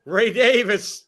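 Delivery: loud drawn-out name call. Real, not acted. Fan calling the runner's name, loud and drawn out. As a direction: stretch the name like an announcer.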